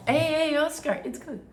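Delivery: high, gentle pet talk; soothing a scared dog. Real, not acted. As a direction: coo at the dog to settle him. Calming the excited dog.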